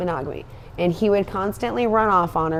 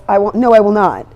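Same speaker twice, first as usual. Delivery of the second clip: firm refusal; 9 dB louder than usual. Retold or imitated. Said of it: "She goes".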